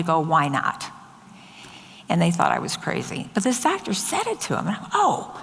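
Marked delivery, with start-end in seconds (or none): 4.9-5.3 high startled "Oh."; a sudden high gasp of surprise; surprised oh. Retold or imitated. Surprised at the doctor.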